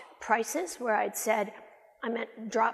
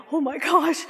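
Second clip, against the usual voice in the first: louder, higher burst of alarm; shocked exclamation; 5 semitones higher, 9 dB louder than usual. Retold or imitated. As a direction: blurt it out in shock.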